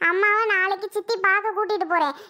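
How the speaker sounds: ordinary delivery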